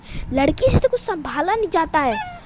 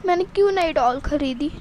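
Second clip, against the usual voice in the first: normal talking voice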